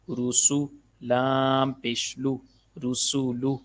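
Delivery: slow, drawn-out letter-by-letter drill; measured Qaida teacher's recitation; spelling drill recitation. Shown as a demonstration. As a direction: intone each letter, then its syllable. Drills spelling out Quranic syllables.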